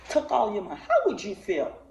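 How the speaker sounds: usual speaking voice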